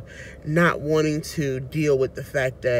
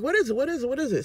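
high-pitched, insistent repeated asking; mock-urgent, rapid-fire plea to know; impatient questioning; 10 semitones higher, much faster than usual. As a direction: retell pestering for an answer, pitched way up. Retold anxious questioning of the doctor.

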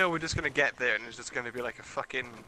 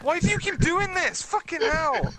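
furious yell, much higher and louder; angry shout; 5 dB louder than usual. Real, not acted. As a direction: explode at your teammate. Teammate shot him.

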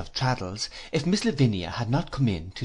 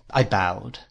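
plain reading voice

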